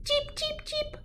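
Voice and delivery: a hint of a baby bird cheeping; bird chirping. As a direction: peep like a tiny chick. Child imitates bird.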